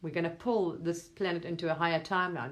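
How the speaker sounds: ordinary delivery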